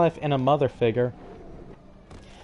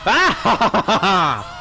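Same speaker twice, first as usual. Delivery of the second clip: loud gleeful yell; excited yell; 5 semitones higher, 5 dB louder than usual. Real, not acted. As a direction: let out a happy yell. Jumping into water in game.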